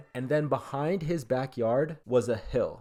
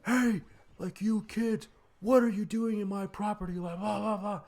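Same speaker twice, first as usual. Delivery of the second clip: high-pitched mock yell of an angry old man; imitating the old guy yelling; 9 semitones higher than usual. Angry property owner yelling at trespassing kids, high mock yell. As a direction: play the cranky neighbor catching kids on his land. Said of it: "yelling at us".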